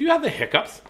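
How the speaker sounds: high-pitched questioning voice; quoted client. Retold or imitated. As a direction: imitate a curious woman on the phone. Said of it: "And she goes".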